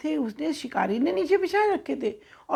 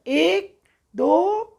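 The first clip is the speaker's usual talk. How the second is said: very slow, higher, louder drawn-out count; 5 semitones higher, 5 dB louder, much slower than usual. Acted. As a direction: count each number out slowly before takeoff. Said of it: "ऐसे गिना". (कबूतरों को उड़ान के लिए धीरे-धीरे ऊँची आवाज़ में गिनती)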